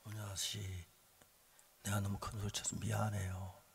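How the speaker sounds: low, hushed retold apology; subdued, conciliatory murmur; humble apology. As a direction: let the anger go and apologize softly. Retold apology after heart changes.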